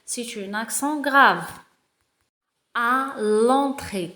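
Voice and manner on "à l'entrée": slow reading aloud. Shown as a demonstration. Teacher reading the text aloud to pupils, very slow. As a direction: read each word very slowly. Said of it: "On va commencer".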